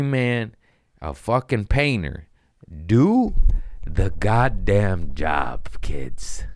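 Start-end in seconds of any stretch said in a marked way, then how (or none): 2.9-5.7 emphatic command. Real, not acted. Comic lecturing the audience, shouted slow command. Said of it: "preaching to kids". 5.8-6.4 whispered aside. Real, not acted. Aside to the audience.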